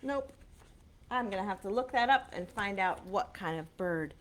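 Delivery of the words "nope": usual speaking voice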